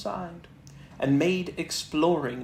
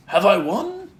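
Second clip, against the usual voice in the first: high, louder cheerful Tigger; 6 semitones higher, 9 dB louder than usual. Acted. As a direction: pop up proud and cheery. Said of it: "and said cheerfully".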